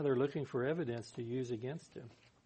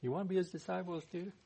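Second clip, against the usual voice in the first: quick, higher-pitched mock question; a little sarcastic; 6 semitones higher, faster than usual. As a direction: toss it out as a sarcastic jab. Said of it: "starting to be a little sarcastic".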